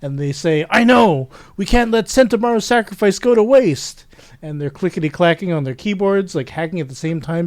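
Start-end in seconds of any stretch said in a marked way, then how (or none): 0.7-4.0 high, loud acted reply; rally them in a raised voice; hammed-up heroic determination; urgent resolve.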